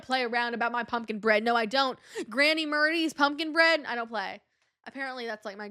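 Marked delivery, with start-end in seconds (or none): none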